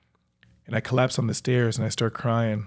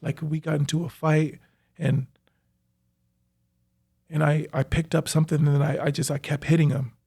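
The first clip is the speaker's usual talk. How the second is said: higher, rushed, confessing voice; remorseful killer on the phone; 5 semitones higher than usual. Retold or imitated. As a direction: confess in a raised, agitated voice. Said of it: "and was like".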